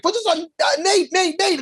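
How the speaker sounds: high, hurried betting plea; eager bettor. Retold or imitated. Scene: bettor urging cashier to bet on Nate, eager. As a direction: rush the name out at the counter. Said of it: "And I'm like".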